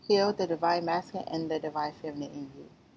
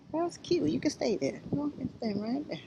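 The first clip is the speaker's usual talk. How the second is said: higher, softer sweet talk to a little bug; cooing high; 7 semitones higher, 5 dB quieter than usual. Real, not acted. Telling the bug in her hair it can stay, softly cooing. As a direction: coax it gently to stay put.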